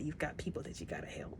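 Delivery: soft breathy whisper, no voice; a hushed plea to stay alive. Retold or imitated. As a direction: whisper it like urging him to stay. Voicing a gentle plea.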